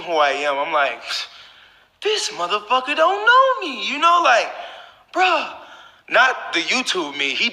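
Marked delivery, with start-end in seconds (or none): 2.0-5.6 much higher and louder, outraged disbelief; mock incredulous inner voice. To viewers, his outraged thought at the insulting stranger, mock high disbelief. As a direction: squeak it out like you can't believe the nerve.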